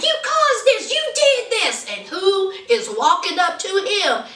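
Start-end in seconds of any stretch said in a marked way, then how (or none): 0.0-1.9 high accusing whine; mimicking a blaming man in a high voice; pointed high-pitched blame; mock Shane. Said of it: "he was like".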